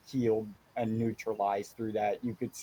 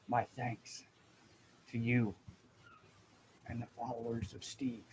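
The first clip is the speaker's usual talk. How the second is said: weak, halting croak, trailing softer; croaked whisper; 8 dB quieter than usual. Acted. Revived gnome thanking his rescuer, in a croaked near-whisper. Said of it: "in a croaked whisper".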